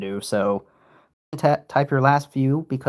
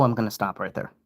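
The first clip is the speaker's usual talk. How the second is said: ordinary talk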